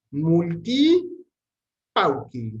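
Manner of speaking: multi called out loud and rising, pauci falling; showcasing the words. Shown as a demonstration. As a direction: present "Multi" loudly with a rise, then "pauci" with a drop. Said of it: "derivan respectivamente muchos y pocos".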